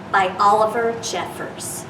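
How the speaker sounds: usual speaking voice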